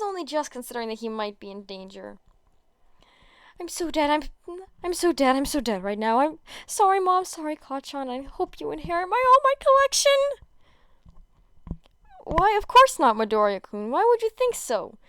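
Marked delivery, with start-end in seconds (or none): 3.6-10.1 higher and louder, rising panic to a squeal; panicked boy. Acted. Boy panics, thinks he'll die. 12.2-15.0 high, loud, strained fake cheer; bad liar. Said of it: "strains his face, bad at lying".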